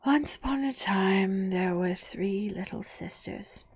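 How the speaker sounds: slow, whispered drowsy telling; sleepy storyteller. Acted. Dormouse beginning the tale, slow and whispered.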